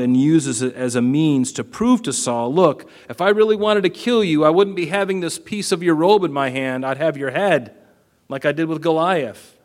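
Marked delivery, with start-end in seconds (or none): 2.5-9.5 raised, higher voice for bold David; David's defiant plea. Acted. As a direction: hold up the robe piece and challenge Saul. Preacher acts David taunting Saul.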